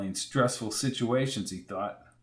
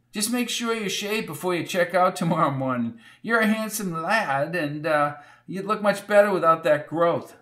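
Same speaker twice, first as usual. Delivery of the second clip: put-on old landlady; 5 semitones higher than usual. A narrator's reading. Landlady teasing.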